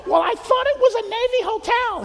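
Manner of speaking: high, loud innocent mom. Retold or imitated. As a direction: play a clueless mother defending herself. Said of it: "he's like".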